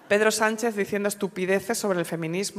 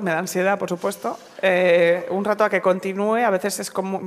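normal talking voice

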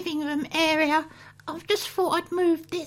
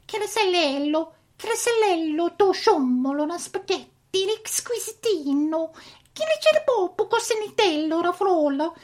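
mock Italian gibberish; hammy pretend Italian accent; fake Italian. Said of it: "You can stop that now".

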